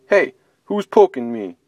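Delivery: grumpy bear woken up; gruff complaint from a sleepy bear; grumbling bear. Acted.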